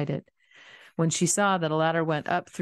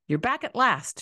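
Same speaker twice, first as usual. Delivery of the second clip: sharp, higher snap of relief and anger; snapped; 7 semitones higher than usual. A narrator's reading. Wife greeting husband at the door, mild snap. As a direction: bite the words off at him. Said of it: "she snapped".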